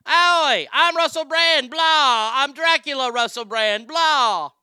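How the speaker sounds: very loud, high, no-effort Dracula; Russell Brand. Acted. Host mocks a comedian playing Dracula, loud careless Russell Brand voice. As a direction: bellow it as a brash British comic, skipping the Romanian. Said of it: "He would put no effort in the Romanian part".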